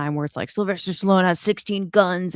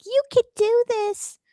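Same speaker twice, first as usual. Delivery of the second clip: squeaky, much higher mock cheerleader; mock girlfriend. Mocking the film's girlfriend to friends, squeaky and gushing. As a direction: parody a gushing girlfriend's pep talk. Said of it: "have a girlfriend that's like".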